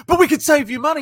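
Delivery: high, loud salesman pleading; pushy salesman. Retold or imitated. Salesman voice pushes back.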